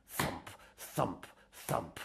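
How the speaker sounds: hushed, soft thumping; whispered thump. Acted. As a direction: mouth the thumps softly. Monster knocking sound.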